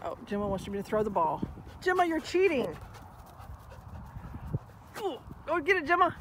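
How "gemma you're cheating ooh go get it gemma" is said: high teasing pet voice; teasing the dog. Real, not acted. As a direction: tease the dog for cheating at fetch.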